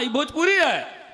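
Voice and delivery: ordinary talk, nothing marked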